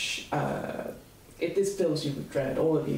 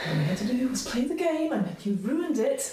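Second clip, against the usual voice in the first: bitter accusation; 9 semitones higher than usual. Acted. Vicky accuses the old party, bitter and high. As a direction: blame them for ruining everything.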